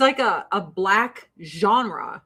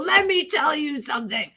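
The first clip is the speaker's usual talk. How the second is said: higher-pitched, put-on comic character voice; Fire Marshal Bill; 4 semitones higher than usual. Kids copying a TV sketch at school, cartoonish impression. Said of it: "everyone was Fire Marshal Bill".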